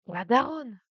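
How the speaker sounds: loud startled cry of alarm. Real, not acted. Streamer spotting the mother in the game, startled. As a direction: yelp it out in surprise.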